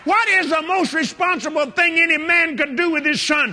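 indignant retort. Retold or imitated. Preacher answering the devil's accusation, outraged shouting. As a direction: fling the accusation back.

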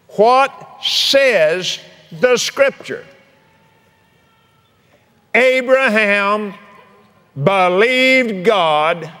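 very slow, weighty reading of the verse; solemn scripture reading. Acted. Preacher reads Romans to the congregation, slow and solemn. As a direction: let each word land like scripture.

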